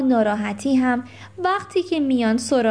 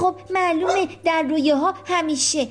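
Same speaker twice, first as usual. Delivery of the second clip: higher, giggling know-it-all; Rocky's voice; 5 semitones higher than usual. Acted. Rocky declaring unicorns live in dreams, high and giggly. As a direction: answer as the proud expert with a laugh.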